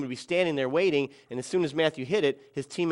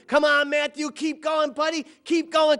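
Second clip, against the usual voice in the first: high-pitched cheering from the crowd; imitating excited spectators; yelling encouragement; 10 semitones higher, slower than usual. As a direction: urge him on, high and eager. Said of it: "hearing people yell to him".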